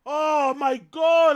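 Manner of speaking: ordinary talk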